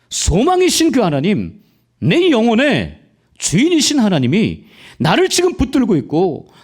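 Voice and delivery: high, loud impassioned sermon cry; fervent preaching. Real, not acted. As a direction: preach it out at full force, rising high. Preaching at full force. (높고 크게 외치는 열정적인 설교)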